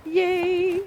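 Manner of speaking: high, loud cheer of delight; excited cheer. Real, not acted. Opening parcels, she cheers with high loud delight.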